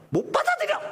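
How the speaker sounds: sudden high, sulking cry of refusal; the offended king refuses to accept it; sulky outburst. Retold or imitated. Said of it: "히스기야 삐진 거야".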